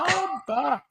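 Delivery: high squeaky altered voice; voice changer. Pretend. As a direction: say it through a chipmunk voice changer. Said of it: "That's my voice changer".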